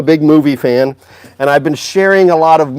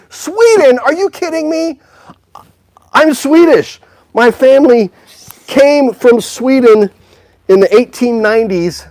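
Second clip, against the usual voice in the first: much higher, giddy excitement over Sweden; host thrilled a viewer joins from Sweden, excited and much higher; thrilled surprise; 8 semitones higher than usual. Real, not acted.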